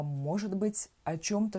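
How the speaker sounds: ordinary talk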